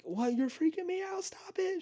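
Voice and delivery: high panicky imitation, trailing to a whisper; imitating a frightened boyfriend; mock scared.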